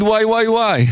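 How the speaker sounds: high-pitched mock play-by-play excitement; excited commentator's wailing. Host explaining the commentator's shouting to listeners, high excited imitation. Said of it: "זה פשוט הדרך שלו להגיד".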